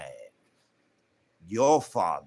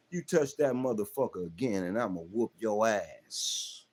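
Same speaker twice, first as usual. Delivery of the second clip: normal talking voice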